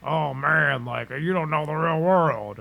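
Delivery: lightly voiced macho bro; a hint of a dismissive tough guy. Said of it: "I'm over-exaggerating the other side".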